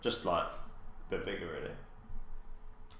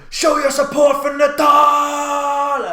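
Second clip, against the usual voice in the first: booming announcer yell, much higher and louder; hype man shout; 16 dB louder, much slower than usual. Retold or imitated. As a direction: fire up the crowd over the mic. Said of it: "a fucking hype man on a mic".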